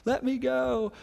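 high, pleading little girl, lightly acted; struggling child. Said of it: "she's struggling and fighting".

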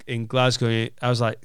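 ordinary talk

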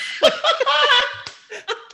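squealing high-pitched giggle; a bright burst of high laughter. Retold or imitated. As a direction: let out a shrill giggle. Laugh inside Paul Rudd quote.

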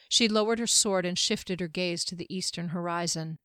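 ordinary delivery

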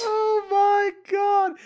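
high shrieking Oh my God, slow and loud; a loud stretched squeal of shock; shocked cry. Real, not acted. Reacting to cellulite question.

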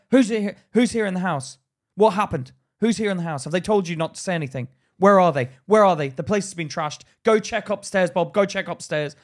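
loud, high, rapid-fire demands; urgent police officer. Retold or imitated. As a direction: bark questions and orders like a raiding officer. Police questioning during house search, acted out.

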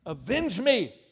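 loud, high cry for vengeance. Acted. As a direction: hurl it out as a demand. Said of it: "What was the blood crying out?".

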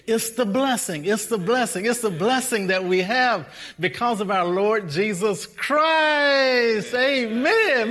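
building from insistent repeats to a high, loud cry; preaching climax. Real, not acted. Preacher proclaiming the blessing through Jesus to his congregation, climactic preaching. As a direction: drive up to a shouted peak.

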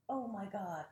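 ordinary talk, nothing marked

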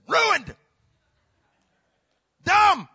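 high, loud barked verdicts; sudden mock shout; report card verdict. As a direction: shout each word like a comic stamp. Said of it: "All my report cards should have said".

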